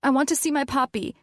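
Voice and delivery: high, fast insistent demand. A narrator's reading. Patient demanding her puppy.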